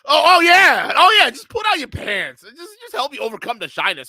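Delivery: mock the excuse sarcastically; sarcastic mock. Streamer mocking the old man's excuse, sarcastic.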